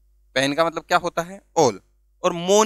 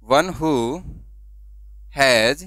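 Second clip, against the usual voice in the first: long pauses between each word, as if writing; dictated word by word; 2 semitones lower, much slower than usual. Shown as a demonstration. Teacher dictating the definition while writing it for students. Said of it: "यहां लिख देता हूं".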